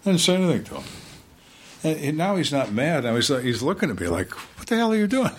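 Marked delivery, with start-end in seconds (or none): 4.6-5.3 high-pitched incredulous question; retold bewilderment, voice jumping up high; mock-baffled prisoner; baffled suspect. Acting out suspect's baffled reaction.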